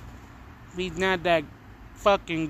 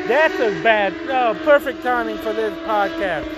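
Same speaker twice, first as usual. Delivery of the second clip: annoyed outburst; 9 semitones higher, 6 dB louder than usual. Real, not acted. Loud cars outside interrupt podcast.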